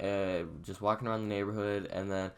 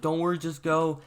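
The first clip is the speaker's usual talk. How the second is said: higher, louder imitation of a hurried friend; retold urgent urging; 8 semitones higher, 5 dB louder than usual. Fleeing friend urging others on, retold urgency. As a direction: hurry them along, acting out the friend.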